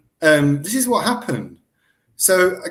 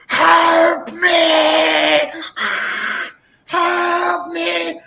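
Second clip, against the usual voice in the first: spectral ghost cry; much slower than usual. Retold or imitated. Retelling the ghost heard in the cabin at night, high wailing spectral cry. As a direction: imitate the ghostly cry for help, strained and unearthly. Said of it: "I can't even emulate it".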